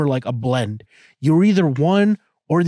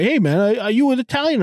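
voicing an Italian guy, pitched way up; 9 semitones higher, faster than usual. Teller imitates an Italian stranger asking his background, high mock voice. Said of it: "I have a fucking Italian guy".

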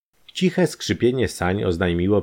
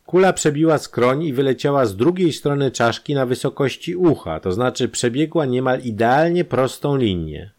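higher and louder expert explanation; lecturing doctor; 8 semitones higher, 4 dB louder than usual. A narrator's reading. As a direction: lay out the proof point by point. Doctor explains bullet path.

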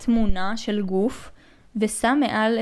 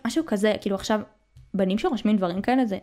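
usual speaking voice

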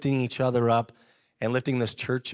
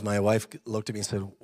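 ordinary delivery

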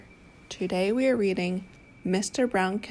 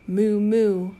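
cow moo; 6 dB louder than usual. Pretend. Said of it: "He can sound like a cow".

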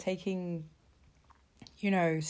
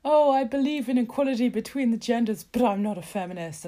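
mocking Dominic Raab; 6 semitones higher, 8 dB louder than usual. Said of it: "was like".

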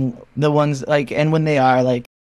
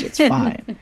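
soothing friend; 11 semitones higher than usual. Retold or imitated. Friends reassuring her.

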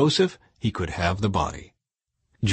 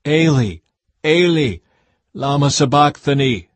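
louder, higher anguished calling out; Jesus cries out to God, loud; loud cry; 4 semitones higher, 6 dB louder than usual. Acted. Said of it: "Jesus called out with a loud voice".

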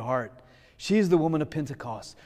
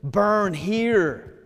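raised, louder pleading prayer; heartfelt prayer; 4 semitones higher, 4 dB louder than usual. Shown as a demonstration. Preacher showing the congregation how to pray, fervent plea. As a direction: cry out to the Spirit to burn the sin away. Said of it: "I'm gonna say".

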